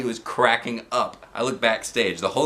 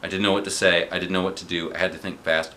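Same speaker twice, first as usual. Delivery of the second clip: ordinary delivery, nothing marked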